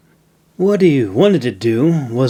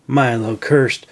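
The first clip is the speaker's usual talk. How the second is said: ordinary delivery, nothing marked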